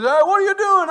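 loud, high pushy boss on the phone. Acted. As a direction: bark it like the boss who won't leave you alone. Boss calling on day off.

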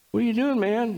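a hammed-up what-are-you-doing; incredulous question.

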